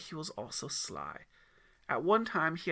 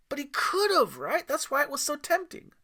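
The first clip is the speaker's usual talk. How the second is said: put-on high, louder boy pressing his point; insistent kid; 12 semitones higher, 8 dB louder than usual. Aiden presses the mountain man, high insistent kid voice. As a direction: push back like a stubborn kid.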